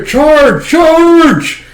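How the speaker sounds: high, loud war cry; rally the troops forward; battle cry. Acted.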